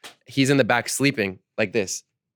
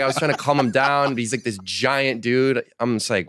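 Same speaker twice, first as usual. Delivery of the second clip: ordinary talk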